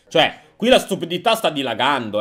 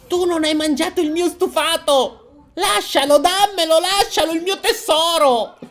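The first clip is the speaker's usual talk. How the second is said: very high, creature-like wail; high-pitched, obsessive character voice; crazed possessive mother; 10 semitones higher than usual. Acted. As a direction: plead madly for your precious treasure. Voices the mad mother, Gollum-like.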